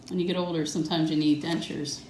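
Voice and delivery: usual speaking voice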